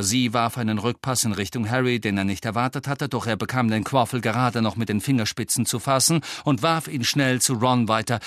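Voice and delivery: plain reading voice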